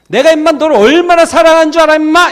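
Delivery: loud, high-pitched scolding; exasperated dad. Acted. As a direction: scold with love behind the shouting. Acted father shouting his love. (답답한 아빠처럼 높고 크게 호통)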